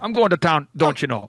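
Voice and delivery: a hint of Minnesota for the learner; Minnesotan accent. Shown as a demonstration. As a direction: model line in a light Minnesotan accent. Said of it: "go ahead and Colton, try".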